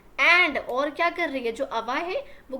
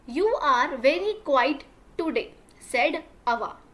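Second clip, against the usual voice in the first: slow read-aloud; 3 dB louder, much slower than usual. Shown as a demonstration. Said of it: "first reading of the mother's line".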